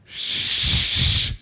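loud, drawn-out hiss of shh; long shush. Acted. Crowd to blind Bart, shushing. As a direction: silence him with one long shush.

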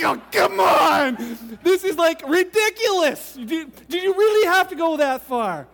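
loud, high, drawn-out come on; exasperated plea. Retold or imitated. Husband begging his wife to stop, loud exasperated plea. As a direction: plead loudly, embarrassed and pained.

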